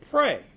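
Pray, shot out very high and loud; Pray, hit hard and high like a command; emphatic. Real, not acted. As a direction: bark the single word Pray. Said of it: "it says right here".

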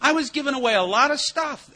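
ordinary talk

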